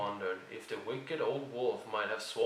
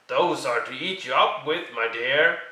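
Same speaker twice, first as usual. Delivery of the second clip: menacing wolf; 2 semitones higher, 8 dB louder than usual. A narrator's reading. Wolf reveals himself.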